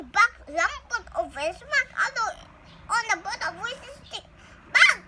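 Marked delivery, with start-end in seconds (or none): 4.7-5.0 scolding. Acted. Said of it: "scolded Papa".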